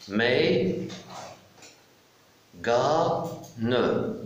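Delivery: "Mais, ga, ne" stretched, long gaps between syllables; split into syllables. Shown as a demonstration. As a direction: sound out "Mais, ga, ne" piece by piece. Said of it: "je découpe le mot". (« Mais, ga, ne » syllabe par syllabe, lentement)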